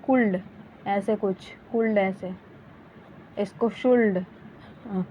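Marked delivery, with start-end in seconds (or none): 0.0-0.4 कुल्ड with an audible l; mistaken could. Shown as a demonstration. Teacher imitating students' mistake, mispronounced. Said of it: "ऐसे नहीं पढ़ा जाता है". 3.7-4.2 शुल्ड with the l sounded, shoold; mistaken should. Shown as a demonstration. Teacher showing a reading to avoid, mispronounced. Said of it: "ऐसे नहीं पढ़ा जाता है".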